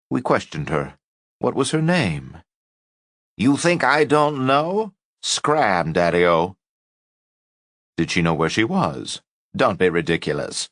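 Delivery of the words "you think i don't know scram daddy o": put-on scornful old woman. A narrator's reading. Old woman snaps at doctors.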